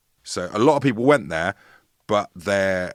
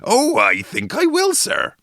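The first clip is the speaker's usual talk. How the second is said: high, squeaky goblin voice; Hoggle voice; 5 dB louder than usual. Acted. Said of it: "ask Hoggle, who from Labyrinth is doing this reading".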